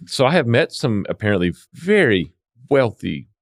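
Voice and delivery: usual speaking voice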